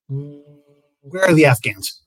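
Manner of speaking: loud, drawn-out question; pointedly slow, loud ask; mock pointed question. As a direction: stretch it out loudly to make the point. Pointed question in staff meeting.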